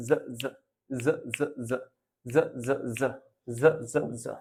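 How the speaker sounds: জ repeated softly; phonics drill. Shown as a demonstration. Teacher drills the j sound. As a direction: tap out each জ. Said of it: "sound like জ".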